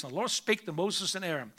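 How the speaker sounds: ordinary talk, nothing marked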